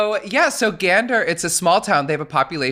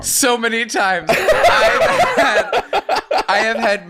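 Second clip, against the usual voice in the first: laughing; 12 semitones higher, 5 dB louder, much slower than usual. Real, not acted. Laughing at friends losing.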